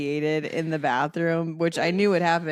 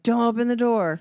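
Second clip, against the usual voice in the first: loud, higher warning; warning shout; 6 semitones higher, 6 dB louder than usual. Retold or imitated. Parents warn the other son off the door, loud. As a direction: call out to stop him.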